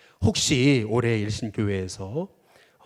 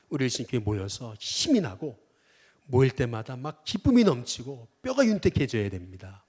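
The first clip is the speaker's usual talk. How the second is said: ordinary delivery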